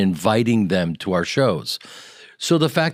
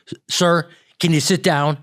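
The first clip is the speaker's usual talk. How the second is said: waitress asking; 8 semitones higher than usual. Retold or imitated. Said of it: "the woman goes".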